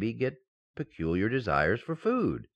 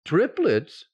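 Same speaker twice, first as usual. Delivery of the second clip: shocked; 5 semitones higher, 6 dB louder than usual. Acted. [name] shocked at triplets news.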